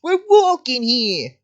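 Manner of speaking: slow, high, drawn-out New York accent; hammed-up New York movie tough guy; Joe Pesci. Shown as a demonstration. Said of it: "I start sounding like Joe Pesci".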